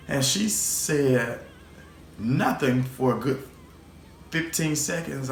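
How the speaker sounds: ordinary talk, nothing marked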